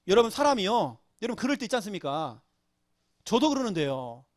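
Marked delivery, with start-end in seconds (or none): none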